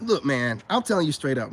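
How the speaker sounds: ordinary talk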